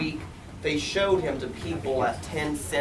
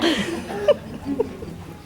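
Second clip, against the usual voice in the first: excited laugh; 13 semitones higher than usual. A narrator's reading. Excited laugh after Horton's shout.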